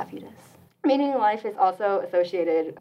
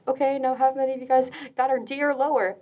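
higher, brisk announcer voice of a staff member; mock teacher-style address to the room; imitating school associate; 6 semitones higher than usual. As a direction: lightly mimic a cheery lunchtime announcement. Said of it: "she goes".

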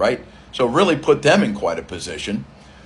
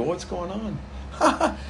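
puzzled ask; 9 semitones higher, 6 dB quieter, much slower than usual. Retold or imitated. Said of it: "I says to the lead guy, I go".

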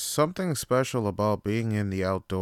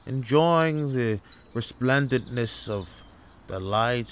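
put-on genteel English accent, savouring the scenery; hammed-up refined English camper. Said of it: "what the fuck is up with this English accent?".

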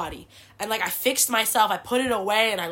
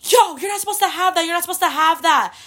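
higher, louder yell after someone; imitating a yell; 8 semitones higher, 6 dB louder than usual. Guard yelling after the fleeing girl, a mock shout. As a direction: call out the rule at a runner. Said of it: "I could hear the girl yelling".

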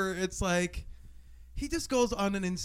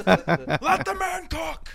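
echoed hype; 6 semitones higher, much slower than usual. Real, not acted. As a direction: repeat it drawn out and cheering. Echoing the hype.

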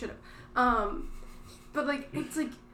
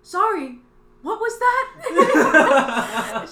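high, loud mock automated voice; phone robot; 5 semitones higher, 13 dB louder, much slower than usual. Caller imitating the robot not understanding, mock operator voice. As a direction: drag it out, breaking into laughter. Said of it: "Talking to the robot on the phone".

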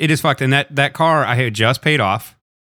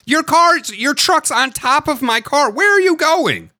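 incredulous high yell; slower than usual. Retold or imitated. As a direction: yell it in disbelief. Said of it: "My thought was".

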